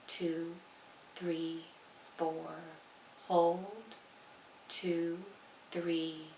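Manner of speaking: soft, measured meditation voice; guided meditation. Acted. Leading listeners through even count breathing, soft and calm. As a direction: count the breath quietly, leaving calm space between words.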